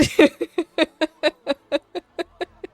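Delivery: long, high staccato giggle; drawn-out ha-ha-ha trailing off; laughing. Real, not acted. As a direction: laugh in a rapid, bouncing run. Laughing at own joke about graph.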